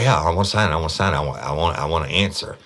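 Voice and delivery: high, loud, whiny parody of the suspect; mocking eager suspect. Cop parodying the suspect who begs to answer questions, high loud whine.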